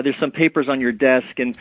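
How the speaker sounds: ordinary talk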